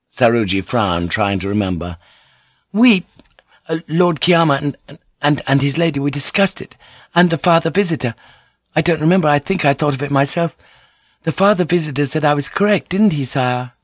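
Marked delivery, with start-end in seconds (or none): 2.7-13.7 put-on lightly raised young man's voice, hesitant; a nervous son stumbling for words; anxious youth.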